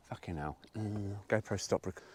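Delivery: usual speaking voice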